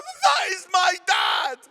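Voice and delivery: slow, very high, loud proud cry; telling fellow runners about his dad, a slow loud high shout; emotional shout. Retold or imitated. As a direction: announce it to strangers, overcome. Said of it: "I'm starting to bawl my eyes out".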